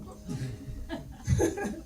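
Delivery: breathy high chuckle; high laugh. Real, not acted. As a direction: laugh at the gossips. Laugh about gossips. (risa aguda)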